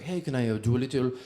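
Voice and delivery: light put-on French accent asking politely; playing a French reporter, French accent; mock French reporter in a French accent. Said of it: "I'd walk up to".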